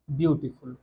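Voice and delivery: normal talking voice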